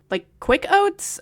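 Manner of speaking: drawn out, confused; slow puzzled question. Real, not acted. Reader puzzling over the book aloud, slow and confused. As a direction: stretch it out in disbelief. Puzzled aside about oatmeal.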